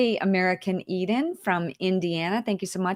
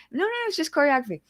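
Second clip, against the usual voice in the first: quick, high-pitched mock denial; hurried protest; 7 semitones higher, much faster than usual. Retelling her answer to Rocco about the video, hurried protest. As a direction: rush out a defensive reply, pitched up.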